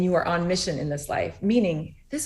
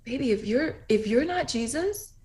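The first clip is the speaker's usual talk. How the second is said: much higher, sing-song motherly voice, slower; tender reassurance; 8 semitones higher, 5 dB louder than usual. Retold or imitated. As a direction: sweetly comfort a little child, a bit louder toward the end. Mother reassuring daughter at bedside.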